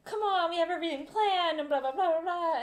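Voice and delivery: high mock protest; pleading. Travellers begging the border guard, pleading.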